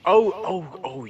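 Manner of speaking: high and loud eager oh oh; sudden gleeful oh; excited oh. Real, not acted. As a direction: leap in, thrilled to share. Excited recall of story.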